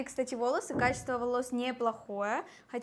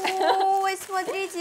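excited; 7 semitones higher, 6 dB louder, much slower than usual. Real, not acted. Excited about the gift.

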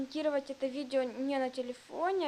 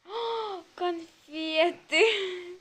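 long drawn-out, higher and louder; delighted find; 5 semitones higher, 6 dB louder, much slower than usual. Real, not acted. Girl spotting her candies, drawn-out delight. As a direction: stretch the word in happy surprise.

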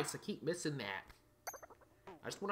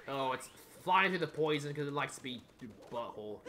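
ordinary talk, nothing marked

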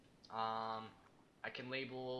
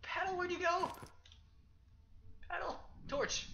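calling out; 14 semitones higher, 3 dB louder than usual. Real, not acted. Calling out for lost paddle.

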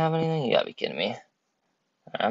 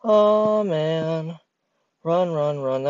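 being chased; 7 semitones higher, much slower than usual. Real, not acted. Warden chasing, high panic.

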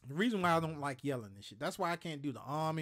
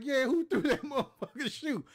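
higher, louder angry comeback breaking into a laugh; pissed-off shout; 9 semitones higher, 6 dB louder than usual. Retold or imitated. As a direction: shout it back, furious yet laughing. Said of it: "Man, I was, I was pissed off".

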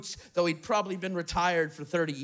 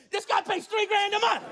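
much higher outraged yell, every word hammered; disbelieving shout; 11 semitones higher than usual. Retold or imitated. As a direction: yell it to the whole empty street. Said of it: "He's just shouting it".

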